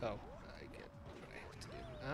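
whispered mock realisation; whispered resolve. Player deciding to act, whispered mock resolve. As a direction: breathe it like a secret vow.

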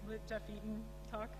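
ordinary talk, nothing marked

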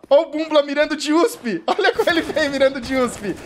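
high, loud, laughing through the words; amused at a player aiming with a pistol; laughing commentary. Real, not acted. Watching a player creep in with a pistol, laughing while calling it.

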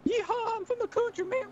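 very high, whooping hillbilly twang; goofy high-pitched hillbilly accent. Acted. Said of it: "a hillbilly accent".

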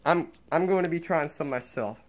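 muffled behind cloth mask; speaking with mouth covered; through a face mask. Real, not acted.

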